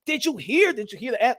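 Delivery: ordinary talk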